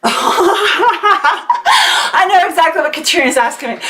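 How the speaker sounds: breaking into laughter, slowed down; laughing. Real, not acted. Laughing as she reads Katrina's question aloud. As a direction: laugh as you read the question.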